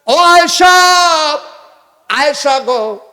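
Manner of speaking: high, loud drawn-out chanted call; ringing melodic summons. Acted. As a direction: sing out the name as if calling someone far off. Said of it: "ডাক দিয়া কয়".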